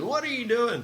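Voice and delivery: abrupt demand. Retold or imitated. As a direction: snap the question like a startled client. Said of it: "he can have an abrupt tone sometimes".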